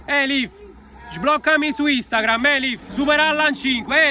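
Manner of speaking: loud, high shouts of names; calling out. Real, not acted. Fans shouting at players leaving training. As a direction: holler across to the cars.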